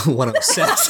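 "one oh six" repeated through a laugh. Real, not acted. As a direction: say "one oh six" again, cracking up.

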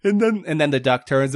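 ordinary talk, nothing marked

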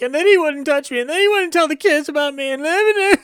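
high, whiny imitation of tearful complaining; mock crying. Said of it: "when she's crying to her mother over the phone".

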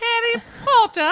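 British accent done badly for laughs. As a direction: squeak the name out in a terrible British accent. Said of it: "I can't do a British accent".

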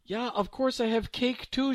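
put-on high, brisk German old lady; happily mishear and offer cake; lightly voiced old lady; cheerful old woman. A narrator's reading.

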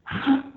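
sharp, high intake of breath; short mock-panic gasp; panicked gasp. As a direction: imitate a sudden gasp of panic. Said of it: "like panic".

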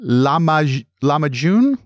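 tentative try at lamacun, rising questioningly; unsure pronunciation. Real, not acted. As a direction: break off at "lamaj-", then try "lamacun?". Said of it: "difficulty pronouncing this dish".

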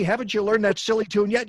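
normal talking voice

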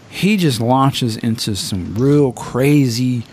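ordinary talk, nothing marked

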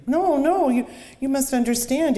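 high, loud, stammering terror; panicked pleading. Acted. Victim begging the killer by the river, high and panicked. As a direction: plead for your life in a rush. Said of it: "Panic flooded him".

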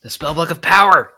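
loud, high excited yell; excited shout. Real, not acted. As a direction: shout it out gleefully.

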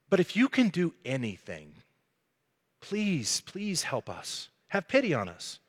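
hushed, halting plea; a desperate father's quiet appeal; pleading. Acted. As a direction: beg softly, with pauses between phrases. Acted father begging Jesus for help.

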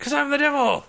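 high, loud mock-villain cry; hammed-up devil. Co-host finishes the pitchfork joke, hammy and squealing high. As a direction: crow it like a cartoon devil.

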